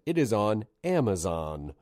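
slowed down and clearly spaced; slow, deliberate repeat. Shown as a demonstration. Host redoing a rushed plug for listeners, slow and deliberate. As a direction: say it carefully so it lands. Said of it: "gonna say it again".

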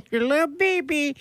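put-on high cooing baby talk. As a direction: coo at a tiny baby. Cooing at shrunken husband.

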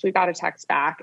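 usual speaking voice

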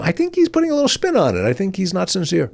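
high, fast sceptical audience voice; mock audience suspicion. Imitating audience doubting his sincerity, high mock voice. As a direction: sound like a doubting viewer. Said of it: "the audience would go".

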